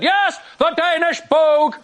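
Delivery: high, slow, emphatic bluffing dad; increased confidence. Retold or imitated. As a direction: double down with hammed-up certainty. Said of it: "increased confidence".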